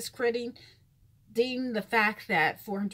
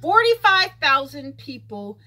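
loud outburst; 4 semitones higher, 12 dB louder than usual. Real, not acted. Shocked at covid case numbers.

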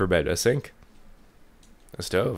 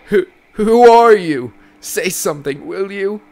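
high, loud panicked cry; frightened shout; 9 semitones higher, 13 dB louder than usual. Acted. Mother terrified of the man in her room, shouting. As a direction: scream at the stranger in fear.

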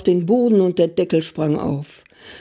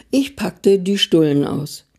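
ordinary delivery

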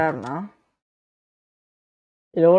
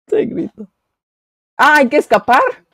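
loud, high frantic pleading; panicked yelling; 4 semitones higher, 10 dB louder than usual. Real, not acted. Scared player wants to stop playing, loud panic. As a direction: beg to quit in a loud rush.

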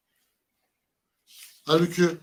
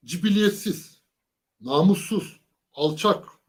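each insult hit hard and louder, mimicking Tayyip abi; mock emphatic insults; 2 semitones higher, 5 dB louder, much slower than usual. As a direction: spit out every word of the list with weight. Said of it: "bunları vurgulayarak söylüyor". (vurgulayarak, taklit eden sert hakaretler)

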